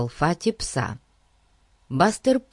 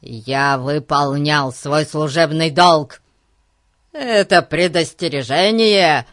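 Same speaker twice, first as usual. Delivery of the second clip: loud, indignant policeman; officious, raised-voice irritation; thoroughly irritated; 6 dB louder than usual. Acted. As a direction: declare it forcefully and crossly. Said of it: "в полном раздражении ответил Гун".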